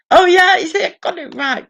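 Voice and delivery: high and loud triumphant cry; excited boast. Real, not acted. Gloating to chat about a quiz answer, excitedly. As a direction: crow about getting it right.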